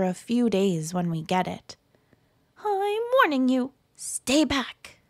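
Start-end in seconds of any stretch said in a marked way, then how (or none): 2.6-5.0 high, sly cartoon virus; menacing germ voice. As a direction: warn them off like a cartoon villain. Norovirus threatens.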